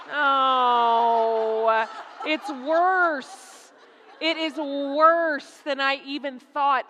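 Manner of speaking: drawn-out groan into laughing words; amused horror, stretched words; laughing dismay. Real, not acted. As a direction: wail it through the laughter.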